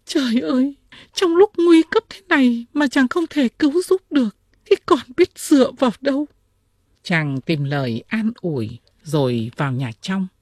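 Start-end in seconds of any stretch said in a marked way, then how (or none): 0.0-6.3 high, loud princess lament; acted high-pitched cry of despair; weeping princess. A narrator's reading. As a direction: wail the reproach as a distressed princess. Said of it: "Công chúa khóc và nói".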